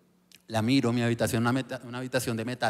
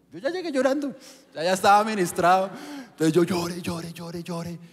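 emotional; 6 semitones higher, 3 dB louder than usual. Real, not acted. Emotional retelling of weeping.

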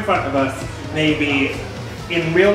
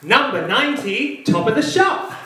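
bingo caller; 5 semitones higher than usual. Acted. Bingo caller calling numbers.

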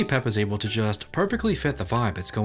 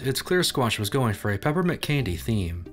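ordinary talk

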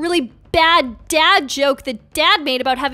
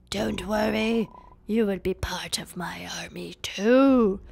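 low, slow hushed villain; sinister villain; 7 semitones lower, 7 dB quieter than usual. Acted. Villain promises players a place in his army, sinisterly. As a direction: sink into a creepy whisper.

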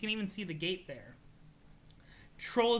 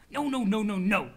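loud, rapid-fire string of no's; acted impatient protest; 6 dB louder than usual. Character correcting friend about the team name, impatient outburst. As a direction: burst in to correct them.

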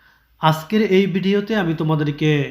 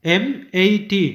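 ordinary talk, nothing marked

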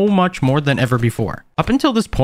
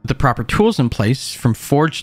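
louder, steady recap narration; voiceover narration; 6 dB louder than usual. A narrator's reading. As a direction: narrate the day like a video recap.

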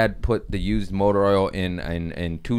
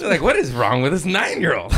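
laughing exclamation; 7 semitones higher, 3 dB louder than usual. Real, not acted.

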